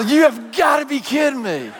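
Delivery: high, excited can't-believe-it cry; thrilled disbelief. Retold or imitated. Pastor retelling being invited to the cockpit, thrilled disbelief. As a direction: burst out in delighted disbelief. Co-pilot offers the front seat.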